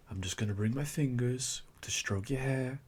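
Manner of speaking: soft intimate whisper close to the ear. Acted. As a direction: whisper it while stroking the hair. Incubus cuddling the listener close.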